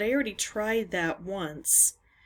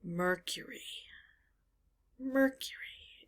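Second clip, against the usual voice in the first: whisper; 8 dB quieter than usual. Real, not acted. Muttering clue words to herself.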